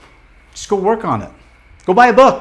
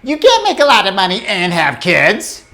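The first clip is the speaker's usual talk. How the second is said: inner critic; 4 semitones higher than usual. Retold or imitated. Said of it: "it's gonna be like".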